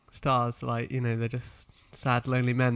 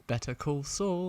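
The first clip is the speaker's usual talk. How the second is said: British accent; 3 semitones higher, 5 dB quieter, slower than usual. Shown as a demonstration. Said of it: "more of a rhythm to it than".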